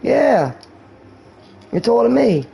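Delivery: high, proud mock reply; imitated boasting. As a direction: answer high, imitating a bragging friend. Acts out friend's boastful answer.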